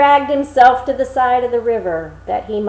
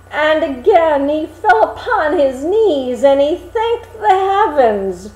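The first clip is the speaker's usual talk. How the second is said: joyful narration; 5 semitones higher, 6 dB louder than usual. A narrator's reading. Narrating Hans's joyful dance.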